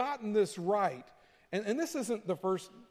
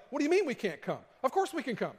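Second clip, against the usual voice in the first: high, fast, half-laughing protest; a flustered disciple pleading, laughing through it; panicky Peter; 4 semitones higher, faster than usual. Retold or imitated. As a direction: play Peter scrambling not to be left behind. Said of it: "And he's like".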